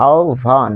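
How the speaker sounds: আহ্বান slowly as "ao-bhan"; careful pronunciation. Shown as a demonstration. Teacher sums up the resulting sound, careful pronunciation. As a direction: stretch both parts of আহ্বান, with bh. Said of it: "পাচ্ছি".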